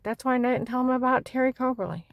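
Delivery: ordinary talk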